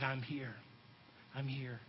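low, hushed reassurance; gentle Jesus. Acted. Jesus comforting Mary with his voice, hushed. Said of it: "He said".

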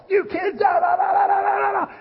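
high, droning babble of yelling; mock parental yelling. Parent always yelling at the kids, mock droning yell. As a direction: nag on one high, unbroken note. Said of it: "always yelling at your kids".

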